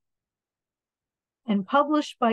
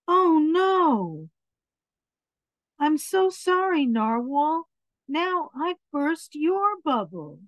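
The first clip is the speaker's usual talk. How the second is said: apologetic character; 6 semitones higher than usual. Acted.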